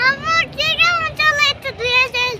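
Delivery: calling out. Real, not acted. Child calling out to mother.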